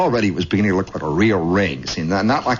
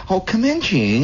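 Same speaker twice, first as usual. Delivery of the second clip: high welcoming lady voice; put-on neighborly greeting; 10 semitones higher than usual. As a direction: invite him in sweetly. Neighbor lady invites him in.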